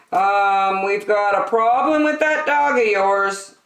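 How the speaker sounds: polite officer breaking bad news; Officer Smedley. A narrator's reading. Pet Officer Smedley speaks.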